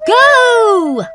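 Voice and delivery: very high, loud, slow starting call; the owl starting the race; race starter call. A narrator's reading. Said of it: "called Wallace".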